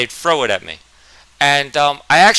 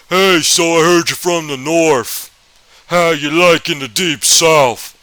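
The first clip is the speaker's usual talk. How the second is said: slow, louder Southern drawl; Southern teacher voice; 4 semitones higher, 6 dB louder, slower than usual. Retold or imitated. History teacher greeting northern kid, slow Southern drawl. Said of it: "That's actually how he sounded".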